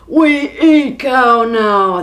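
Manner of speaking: loud, slow giant bellow; giant shout. Acted. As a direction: shout it like a hungry giant, slowly. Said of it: "they shouted".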